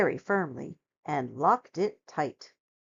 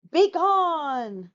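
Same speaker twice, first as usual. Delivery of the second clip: roar; 9 semitones higher, 4 dB louder than usual. A narrator's reading. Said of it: "roared the bear".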